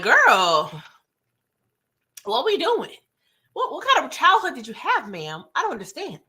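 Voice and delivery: exaggerated, swooping high-pitched disbelief; hammed-up bafflement; incredulous mock scolding. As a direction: call her out, baffled, pitch leaping up. Mock disbelief at character's answer.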